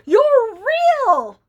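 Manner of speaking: high, loud startled cry; Benny the cat gasping at the talking reindeer; surprised cat. A narrator's reading. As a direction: blurt it out in amazement. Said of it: "Benny was so surprised".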